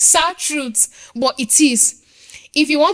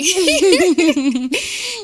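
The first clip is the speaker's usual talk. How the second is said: a short, higher laugh; 7 semitones higher, 4 dB louder than usual. Real, not acted. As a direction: laugh lightly. Laughs again.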